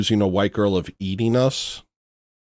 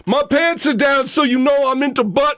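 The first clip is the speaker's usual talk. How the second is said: hammed-up caricature shout. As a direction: shout out a crude boast for laughs.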